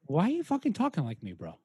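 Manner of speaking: mock-offended Boston celebrity; Mark Wahlberg. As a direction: challenge him in a put-on Boston accent. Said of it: "It's me, it's fucking Mark Wahlberg".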